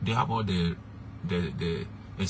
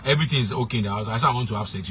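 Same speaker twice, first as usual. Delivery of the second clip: ordinary talk, nothing marked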